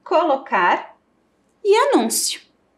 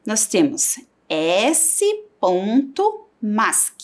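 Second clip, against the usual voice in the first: ordinary delivery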